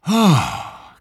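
high, breathy gasp; loud airy oh, falling away. A narrator's reading. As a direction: exhale it sharply after a fiery gulp. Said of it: "gasped the smallest fox".

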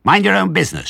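high, loud retort; piercing, indignant squeal; shrieking rat. A narrator's reading. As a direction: screech back rudely. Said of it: "shrieked Rat".

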